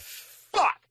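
loud, high-pitched burst of exasperation; re-enacted frustrated yell; frustrated outburst. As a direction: curse as if another take just failed. Retelling frustration with accent practice.

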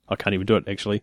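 ordinary talk

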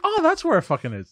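a mock eureka; surprised. Retold surprised realisation.

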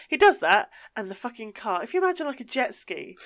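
ordinary delivery

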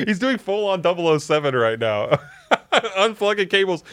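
high giggling through words; cracking up mid-sentence; laughing. Real, not acted. Producer crawling on floor.

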